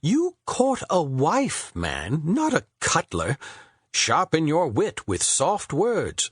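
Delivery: high, slow, lofty poet voice; Shakespeare instructing. A narrator's reading. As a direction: lecture him grandly on wooing. Shakespeare advising.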